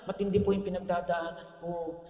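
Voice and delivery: soft, low humble reply; humble soft plea. Retold or imitated. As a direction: answer meekly and gently. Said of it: "Naging mababa siya". (mahinahon at mapagpakumbabang sagot)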